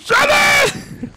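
high, loud shout upward; shouting a name. Real, not acted. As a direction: yell to someone far away. Calling up to a friend.

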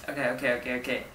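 ordinary delivery

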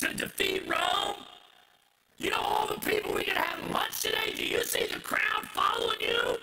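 loud, high, driving sermon voice; preaching at full force. Acted. Preacher to congregation, shouting the disciples' outrage. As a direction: preach it hard, voicing the disciples.

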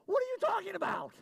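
high, fast mock outburst at the kids; retold angry snap. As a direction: imitate blowing up at one of the kids. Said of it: "Why are you so angry at them".

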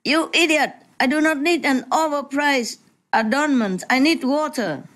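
high-pitched mock outburst, lightly done; hysterical shout. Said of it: "shouted hysterically".

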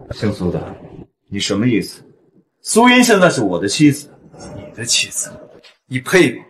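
loud cold challenge; angry. Acted. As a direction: snap at the rival. Husband confronts rival.

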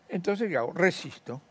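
usual speaking voice

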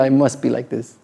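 usual speaking voice

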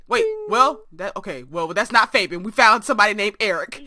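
usual speaking voice

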